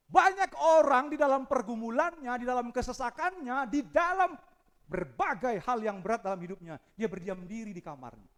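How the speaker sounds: high, loud impassioned sermon; full-force preaching. Acted. Preacher to congregation, impassioned loud preaching. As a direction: preach it hard.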